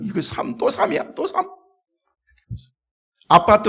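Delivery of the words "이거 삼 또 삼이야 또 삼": climbing higher in hammed-up panic; mock fright. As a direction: squeal it like a man dreading three. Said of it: "사지를 벌벌 떨었을".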